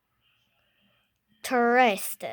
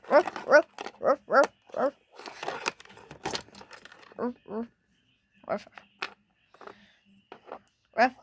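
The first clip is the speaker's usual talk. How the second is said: dog barking. Pretend. Child voicing the puppy toy with barks. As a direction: bark like a puppy.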